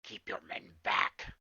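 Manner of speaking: menacing warning. Acted. DM voices threatening figure.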